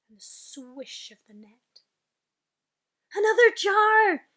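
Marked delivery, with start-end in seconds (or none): 3.1-4.3 high, loud shout; thrilled loud giant cry; excited cry. Acted. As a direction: shout for the jar in triumph. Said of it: "he cried".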